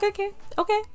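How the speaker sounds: high-pitched imitation of a crying child; mock tearful. Crying pupil told to leave the classroom, mock tearful. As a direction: squeak it out as a hammed-up tearful reply. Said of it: "I was, like, crying".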